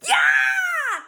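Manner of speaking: a piercing high scream; loud high shriek of pain. A narrator's reading. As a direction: shriek as your hair is yanked. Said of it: "screamed Leslie".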